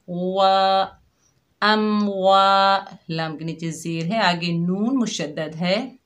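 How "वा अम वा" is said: वा with fully rounded lips, slow. Shown as a demonstration. Teacher showing students lip rounding, slow वा. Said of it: "होंठों को मुकम्मल गोल करेंगे".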